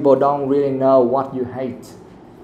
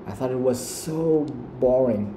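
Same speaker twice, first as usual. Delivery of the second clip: "so" stretched and stressed before "boring"; 4 dB quieter than usual. Shown as a demonstration. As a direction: lean long and hard on "so". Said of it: "That's the way you have to do it".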